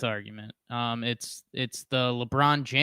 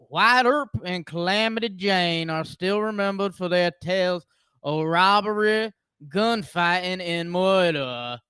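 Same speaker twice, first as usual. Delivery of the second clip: slow, raised storyteller intro; dramatic narration; 7 semitones higher, 5 dB louder than usual. Acted. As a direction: narrate like a documentary opener.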